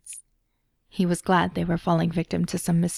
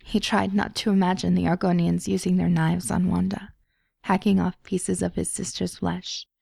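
ordinary delivery, nothing marked

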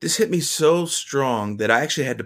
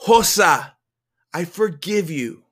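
raised name, then gentler words; higher-pitched, heartfelt pardon; earnest forgiveness; 6 semitones higher, 4 dB louder than usual. A narrator's reading. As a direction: forgive with feeling, holding some back. First attempt, told to say louder.

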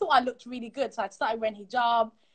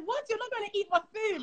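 high-pitched offended disbelief; livid mum; 10 semitones higher, 4 dB quieter than usual. Retold or imitated. Mum hears her food is refused, livid. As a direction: imitate an affronted mum, pitched way up. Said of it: "she was livid".

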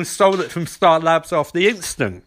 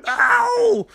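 much higher, hammed-up wail of pain, slowing down; mock pained cry; 12 semitones higher, much slower than usual. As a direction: whimper and yell like a wounded hero, overacted. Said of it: "And Flash is like".

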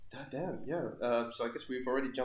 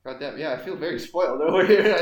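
loud exclamation; 2 semitones higher, 15 dB louder than usual. Real, not acted. Listener exclaims.

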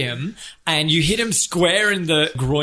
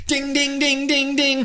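bell imitation; 11 semitones higher than usual.

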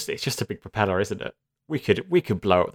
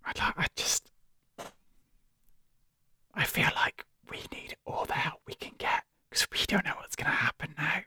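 whispering; 6 semitones higher, 7 dB quieter than usual. Real, not acted. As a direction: hush it so the door keeps it out. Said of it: "just whisper".

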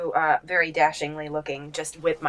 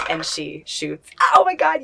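usual speaking voice

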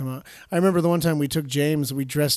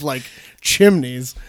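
chimneys said slowly and louder; drawn-out chimneys; 2 semitones lower, 7 dB louder, much slower than usual. Real, not acted. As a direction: stretch chimneys out for the joke. Jeans size of chimneys.

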